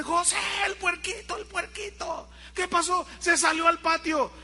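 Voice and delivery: high, urgent calling out to José; alarmed calling. Retold or imitated. Brothers warning José the piglet escaped, high urgent calls. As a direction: shout the alarm across the house.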